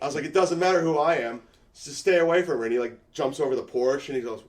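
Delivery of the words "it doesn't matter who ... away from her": tough threat. Retold or imitated.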